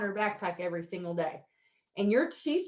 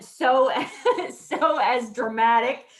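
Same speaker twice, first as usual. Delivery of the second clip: laughing; 7 dB louder than usual. Real, not acted.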